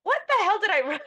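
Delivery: high incredulous yelp; mock horror at her old writing; what the hell. Author rereads her own blog, shocked disbelief. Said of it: "which made me go back and be like".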